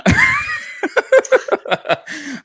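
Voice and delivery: exasperated shrieking cackle; shrieking laugh. Real, not acted. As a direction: burst out in a squealing laugh. Reacting to a disliked winner.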